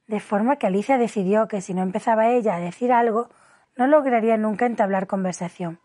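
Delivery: ordinary delivery, nothing marked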